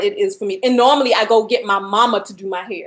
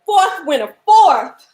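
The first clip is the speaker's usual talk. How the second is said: excited announcement; 7 semitones higher, 4 dB louder, slower than usual. Real, not acted. Announcing giveaway winner.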